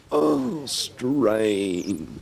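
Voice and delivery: strained mock voice. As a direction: play a part straining hard. Voicing the straining electret.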